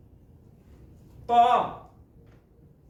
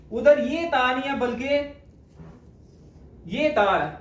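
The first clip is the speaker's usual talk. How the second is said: usual speaking voice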